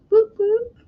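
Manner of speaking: high-pitched whoop of joy; excited whoop. Acted. Streamer cheering her own return, high whoop.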